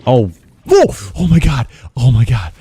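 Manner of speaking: real jump scare panic; panicking gamer. Real, not acted. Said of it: "dude, I actually jumped".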